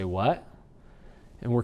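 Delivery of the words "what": "What?" lower and softer with a small rise; real question. Shown as a demonstration. Teacher demonstrates a genuine question "What?". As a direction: ask "What?" low, really wanting an answer. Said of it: "I'll say".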